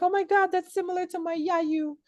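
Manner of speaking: high, excited squeal; excited gush. Retold or imitated. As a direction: gush with delighted surprise. Retelling own excited reaction.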